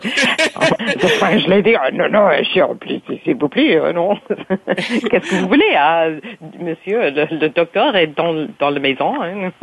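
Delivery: French lady accent, laughing as she finds it. Shown as a demonstration. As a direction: slip into French with a laughing French lilt. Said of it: "if, uh, Doctor Obrecht was French".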